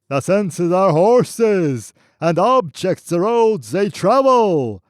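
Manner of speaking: high, loud, slow chant of scripture; slow booming recitation; roaring voice. Acted. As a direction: roar the verse at his back. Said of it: "roaring at his back".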